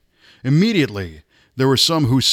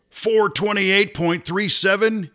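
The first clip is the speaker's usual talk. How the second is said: announcing record results; 8 semitones higher than usual. A narrator's reading. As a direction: call out the record figures, high and measured. Relaying record speeds from timer's stand.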